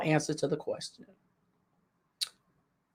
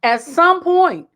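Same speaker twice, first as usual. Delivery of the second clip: normal talking voice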